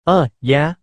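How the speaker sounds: ordinary delivery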